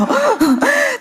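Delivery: high burst of laughter; bright laugh mid-story. Real, not acted.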